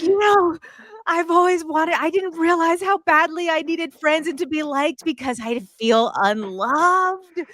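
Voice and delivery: high-pitched mock sobbing wail; imitated crying. Speaker replaying her tearful confession to a friend, mock crying. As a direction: cry it out high, stretching the last word.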